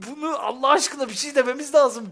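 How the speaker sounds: exasperated. Real, not acted. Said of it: "Çıldıracağım".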